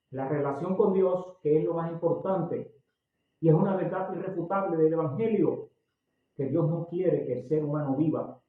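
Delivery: normal talking voice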